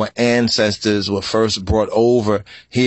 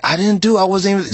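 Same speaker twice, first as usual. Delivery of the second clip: accused denial; 7 semitones higher, much faster than usual. Retold or imitated. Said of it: "accused man's denial quoted".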